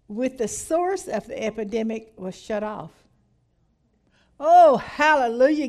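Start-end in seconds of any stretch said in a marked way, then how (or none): 4.4-5.7 loud, high hallelujah burst; joyful shout. Real, not acted. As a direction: shout your praise out loud. Preacher rejoicing aloud.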